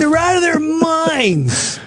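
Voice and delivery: very high, drawn-out cry; exasperated high squeal; emphatic exclamation. Real, not acted. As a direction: stretch it slowly up high.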